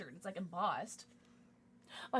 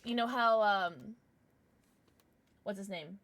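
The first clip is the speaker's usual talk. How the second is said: ordinary talk, nothing marked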